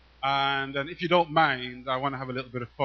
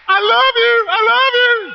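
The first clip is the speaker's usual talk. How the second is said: high, loud call of I love you; 17 dB louder, slower than usual. Real, not acted. Poet calling love to the audience, high and loud. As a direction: call out to the whole crowd.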